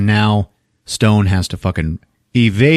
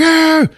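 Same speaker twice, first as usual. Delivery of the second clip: high-pitched imitated yelp; short high "ah" of acted panic; hammed-up startled cry; mock alarmed cry; 4 dB louder than usual. Acts Stone reacting to scream.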